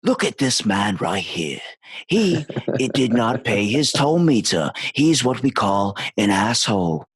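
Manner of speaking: slow, hushed wildlife-film narration; mock nature documentary voice for a man in the street; nature documentary narrator. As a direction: narrate a passer-by like wild animals, slowly. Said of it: "do a nature documentary in my everyday life".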